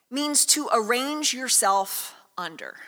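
usual speaking voice

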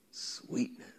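whispered echo of "Sweetness". Acted.

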